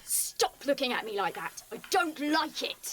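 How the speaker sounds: heated, emphatic rebuke; angry scolding. Acted. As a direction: tell off a leering man. Izzy snaps at leering Alvaro.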